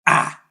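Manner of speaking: short, loud "Ah!"; yelled attack cry. Acted. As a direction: yell out as you lunge at him. Lunging at the big man.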